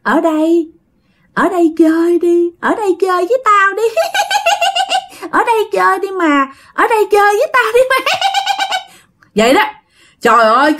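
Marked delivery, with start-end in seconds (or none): 0.0-8.0 put-on high, fast, giggling ghost child; ghost girl voice. Ghost girl calling the child to play, eerie high giggling. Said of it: "cái giọng nói phía sau lưng của bạn kêu rằng". 8.0-8.8 put-on very high creepy ghost-girl giggle; a spooky child's laugh; eerie giggle. Ghost girl laughing behind the child, eerie high giggle.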